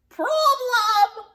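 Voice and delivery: loud, high witch screech. Acted. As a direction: shriek it in outrage. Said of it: "screeched Gritch".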